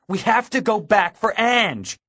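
high, loud, insistent plea; urgent demand. A narrator's reading. Boy demands rescue of his girlfriend, loud and desperate. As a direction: demand they turn back right now.

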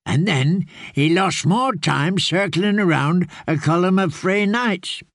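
put-on an old caretaker explaining gently; lightly voiced old man telling the tale; old man's voice. Grey man recounts the hunt.

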